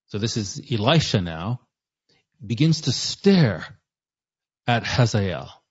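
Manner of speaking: ordinary delivery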